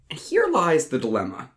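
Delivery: ordinary delivery, nothing marked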